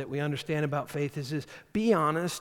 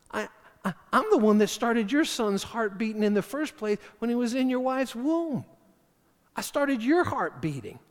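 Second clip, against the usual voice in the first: indignant; 8 semitones higher, 3 dB louder than usual. Acted. Jesus voiced indignantly.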